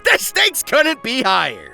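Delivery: normal talking voice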